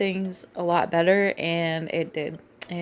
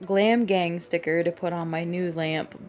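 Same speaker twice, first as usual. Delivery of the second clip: ordinary delivery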